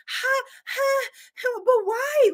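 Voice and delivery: high, laughing, pleading why; high half-laugh. Retold or imitated. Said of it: "And I was like".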